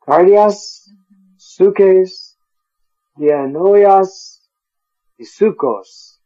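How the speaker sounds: each word lifted, endings rung out clearly; stressing the endings. Shown as a demonstration. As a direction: let the endings chime alike. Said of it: "if you notice the endings of those words".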